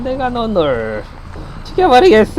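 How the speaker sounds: menacing clown taunt; silly clown. Pretend. Playing killer clown, threat.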